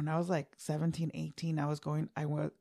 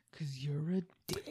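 whispered jab, unvoiced and soft; 9 dB quieter than usual. Husband whispering to his laughing wife, teasing jab. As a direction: breathe it out as a teasing insult.

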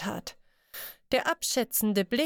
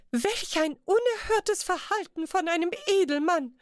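high, indignant lady's scold; shocked high-pitched huff at the rude nobleman; 8 semitones higher than usual. Acted. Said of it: "schimpfte die eine".

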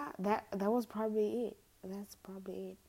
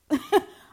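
loud laugh; 10 semitones higher, 15 dB louder than usual. Real, not acted. Laugh at own story.

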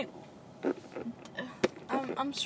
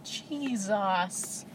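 muttered exasperation; 3 semitones lower, 5 dB quieter than usual. Retold or imitated. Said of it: "Normally, I'm like".

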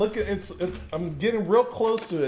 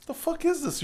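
baffled; 10 semitones higher, 6 dB quieter, faster than usual. Retold or imitated. Acts out own baffled reaction.

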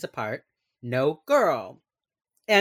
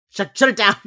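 mock bossy order; 6 semitones higher, 11 dB louder than usual. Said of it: "Gideon is like".

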